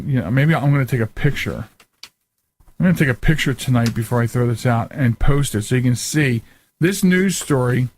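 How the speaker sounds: normal talking voice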